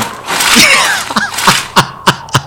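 breathy, sinister high cackle; a chilling villain's laugh; cold laugh. Acted. The mother cackling coldly as she fondles the sharpened spade. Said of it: "Bà cười lạnh".

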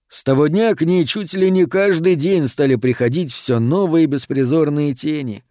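plain reading voice

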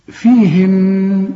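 very slow "فيهن" ending in a long hummed n; held ghunna. Shown as a demonstration. Teacher models a pause on the word, "فيهن" with long hum. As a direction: sustain the final n of "فيهن" evenly. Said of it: "تقف برضه وتقول".

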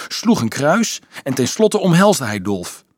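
usual speaking voice

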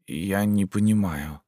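a hint of a weary ghostly man; lightly voiced otherworldly stranger. A narrator's reading. Morty speaks. (слегка потусторонний голос Морту)

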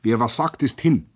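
ordinary delivery

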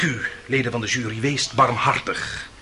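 hushed closing appeal to the jury; quiet plea. Acted. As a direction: drop the voice and beg the jury gently. Lawyer begs jury for mercy.

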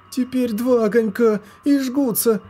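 put-on high shrill girl yelling; child's scream. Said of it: "закричала Лоска".

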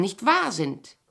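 usual speaking voice

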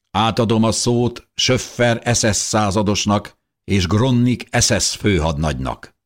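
high, loud formal proclamation; soldier's microphone announcement. A narrator's reading. Soldier hands over to the SS officers, announcing loudly. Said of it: "Egy katona lépett a mikrofonhoz".

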